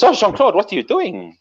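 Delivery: put-on high-pitched French-accented protest; startled Frenchwoman. Woman questioning her lover mid-seduction, startled. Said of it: "she goes".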